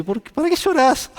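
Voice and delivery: put-on high, light child asking why; a hint of a confused small child; child's puzzled question. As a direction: voice a little kid puzzled by tears. Child voiced in example.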